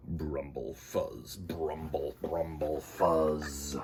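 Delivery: low, soft rumbling sound effect; spooky low alien rumble; mysterious noise. Acted.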